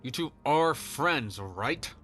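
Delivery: slow, pressed through clenched jaw; dad forcing the boys to be friends; through gritted teeth. Acted. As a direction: force the words out tightly. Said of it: "through gritted teeth".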